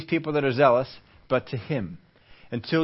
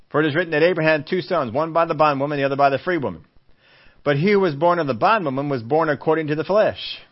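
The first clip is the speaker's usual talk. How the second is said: louder, emphatic scripture reading; preached scripture; 3 semitones higher, 3 dB louder than usual. Acted. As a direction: proclaim the verse. Reads scripture at force.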